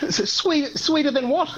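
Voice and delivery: high puzzled mock local, rising on "what"; confused local. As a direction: play a baffled Aussie, squeaking up high. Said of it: "they look at me like".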